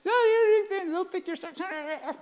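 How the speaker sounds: very high, cartoonish squeaky chatter; hammed-up high-pitched worrier; nagging inner voice. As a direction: gabble like a tiny voice in the head. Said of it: "this voice says".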